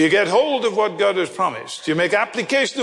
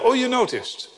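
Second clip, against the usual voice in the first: ordinary talk, nothing marked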